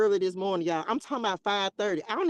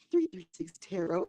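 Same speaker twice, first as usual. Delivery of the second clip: softer, prim imitation of my old self; old reserved persona voice; 3 semitones lower, 5 dB quieter than usual. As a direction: mimic my old timid intro. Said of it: "Like, who is that?".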